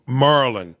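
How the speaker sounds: "Maryland" clipped to "Murlin"; regional Maryland pronunciation. Shown as a demonstration. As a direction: say "Maryland" like a local, MUR-lin.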